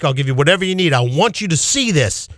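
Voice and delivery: normal talking voice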